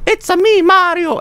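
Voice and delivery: Mario impression. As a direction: pitch way up and chirp it like Mario. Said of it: "something you'd make in Mario sixty-four".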